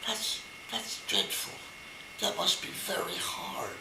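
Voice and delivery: hushed, breathy whisper of pity; hushed sympathy. Acted. Father whispering sympathy to the rabbi. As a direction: whisper it like bad news.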